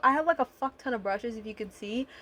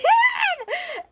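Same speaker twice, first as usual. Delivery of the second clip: shrieking laugh; 7 dB louder than usual. Real, not acted. Bursts out laughing at herself.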